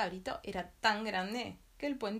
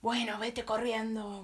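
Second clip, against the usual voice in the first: loud, slow troll grumble; troll grudgingly sending the goat off, loud slow grumble; grumbling troll; 2 semitones higher, 6 dB louder, slower than usual. Acted. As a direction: growl it slowly, grudgingly letting him go. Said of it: "gruñó el ogro".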